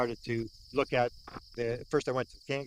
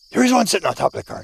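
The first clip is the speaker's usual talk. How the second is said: excited; 6 semitones higher, 9 dB louder, faster than usual. Retold or imitated. Spotting a second light.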